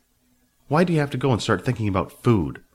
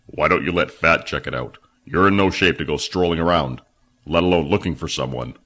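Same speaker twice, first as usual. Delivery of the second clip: lower, gruff reasoning; Phil's voice; 3 semitones lower than usual. A narrator's reading. Phil urging injured Billy to rest, low voice. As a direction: talk him out of it.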